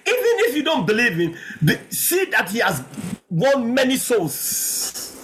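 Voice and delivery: high, stretched pulpit cry; full-force preaching. Real, not acted. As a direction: hold the key words long and high.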